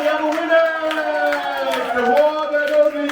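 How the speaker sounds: high, loud winner's call; excited announcement. Acted. Host announces the winner to the crowd, thrilled and loud. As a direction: crow it like a game-show host.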